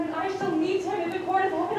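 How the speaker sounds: usual speaking voice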